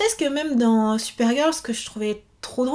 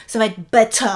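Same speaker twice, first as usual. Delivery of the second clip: "better." with a crisp British t; clipping "better." the British way with a clear t; British accent; 3 semitones lower, faster than usual. Shown as a demonstration. Warning about listening exams, "better." in a British accent. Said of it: "si jamais c'est un accent, euh, british".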